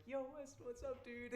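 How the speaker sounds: high-pitched mock greeting; imitated classmates. Classmates greet boy back after absence, imitated high-pitched hello. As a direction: hail a returning pal in a put-on high voice.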